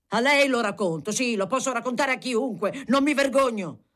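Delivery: defiant outburst. A narrator's reading. Deputy telling the clerk he's not ashamed, defiant. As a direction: throw it out proudly, unashamed.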